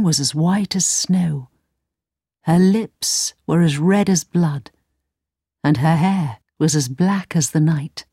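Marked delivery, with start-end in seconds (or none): none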